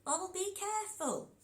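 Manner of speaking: put-on a child reassuring her mum; little girl voice. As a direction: promise it like a small girl.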